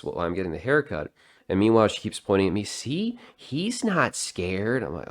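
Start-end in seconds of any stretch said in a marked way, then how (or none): 2.6-4.8 high, drawn-out mock mom voice; slow singsong put-on coaxing; mimicked coaxing mother. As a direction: imitate a mother reassuring her kid, pitched up. Mimics mother coaxing her scared child.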